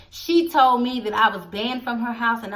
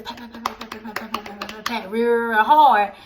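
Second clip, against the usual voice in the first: imitated footsteps. To her viewers, imitating footsteps coming down the stairs. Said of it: "footsteps coming down the stairs".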